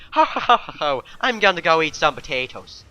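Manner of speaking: mock Irish accent. Imagining a TV presenter mocking the Irish, in Irish accent. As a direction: put on a hammed-up Irish accent. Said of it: "did an Irish accent".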